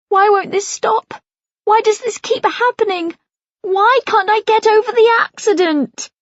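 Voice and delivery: high, pleading cries of despair. A narrator's reading. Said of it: "I want to wail with despair".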